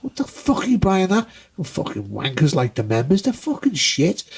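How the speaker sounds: scorn the record like a snob; mock disgusted rant. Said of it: "He goes".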